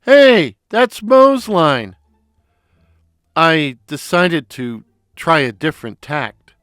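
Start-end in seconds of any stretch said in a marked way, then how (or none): 0.0-2.0 high, loud stooge protest; indignant Curly. A narrator's reading. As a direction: protest loudly in a high comic voice. Curly protests at the stolen line.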